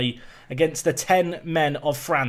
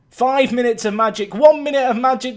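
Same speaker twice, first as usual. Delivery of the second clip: louder and higher, pleading for magic; desperate rally; 7 semitones higher, 6 dB louder than usual. Real, not acted. Manager pleading with tired players, a desperate louder shout.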